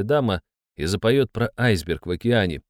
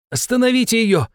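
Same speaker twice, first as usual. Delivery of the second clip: much higher, loud desperate plea; shouting over noise; 12 semitones higher, 4 dB louder than usual. Acted. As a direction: yell over a screeching singer. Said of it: "попросил я, зажимая уши ладонями".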